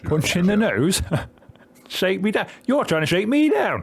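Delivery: high-pitched, hammed-up indignation; mock outrage. Game character accusing a rival of a shakedown, hammed-up outrage. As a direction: build to an incredulous, squawked protest.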